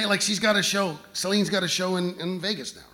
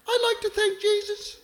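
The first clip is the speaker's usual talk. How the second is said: much higher, far-off diva voice. Retold or imitated. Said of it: "she always hitting herself on the chest".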